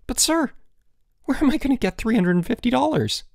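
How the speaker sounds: very high-pitched, alarmed schoolboy; dismayed protest. A narrator's reading. Student protests a huge fine to the headmaster, dismayed.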